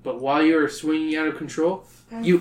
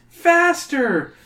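urgent shout; 12 semitones higher, 3 dB louder, much slower than usual. A narrator's reading. Urging Liz to climb faster.

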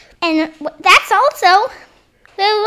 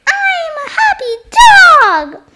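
happy toy dog; 13 semitones higher, 5 dB louder than usual. Pretend. Fetch says he's happy.

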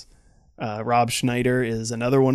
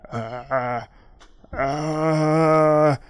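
zombie groan; 3 semitones higher than usual. Acted. Zombie without a voice, dull groan. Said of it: "instead of just being like".

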